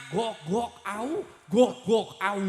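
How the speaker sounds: dog barking. Retold or imitated. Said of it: "Anger we atuh sorana".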